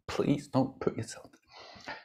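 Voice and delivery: slow, hushed begging; whispered plea. Acted. Buddhas pleading with you, slow whisper. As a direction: implore them in a soft whisper.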